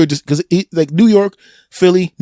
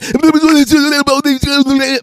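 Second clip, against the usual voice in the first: high, very fast mock chatter; high-pitched speed-talking gibberish; rapid-fire gibberish. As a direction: babble wordlessly at top speed. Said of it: "at that speed".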